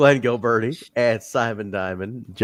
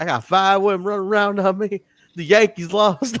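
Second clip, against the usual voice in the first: southern voice; 8 semitones higher than usual. Retold or imitated. Retelling the promo again to show the southern voice. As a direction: imitate a southern cowboy, pitched up. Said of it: "he's saying it in a, like, a southern voice".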